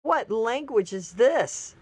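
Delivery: slow, drawn-out what language; slow disbelief. Real, not acted. Reacting to the Democrats' insult with slow disbelief. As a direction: stretch each word out in disbelief. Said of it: "slow mock disbelief".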